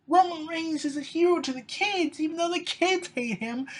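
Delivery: high-pitched, amused delivery. Real, not acted. Wrestling fan mocking the hero to the kids, amused and high. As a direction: pitch it high, still tickled.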